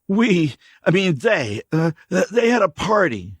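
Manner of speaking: high, loud, tripping over his words; stumbling drifter. Acted. Stranger slipping up under K J's stare, stumbling. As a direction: catch himself mid-slip. Dirty man's voice.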